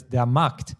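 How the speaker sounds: der Markt with K and T joined directly; no E before T. Shown as a demonstration. Teacher modelling for a learner, der Markt with no E between K and T. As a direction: say Markt in one syllable, ending in kt. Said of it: "There is no letter E between K and T".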